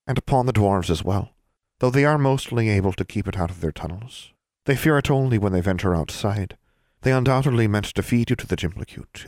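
a prim little elf explaining his folk; proud elf. Acted. Elf Seas from Laurel speaking.

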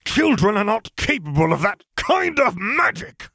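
high, slow, furious shout. Acted. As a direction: slam the table and shout it. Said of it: "slammed his hand ... with all the fury".

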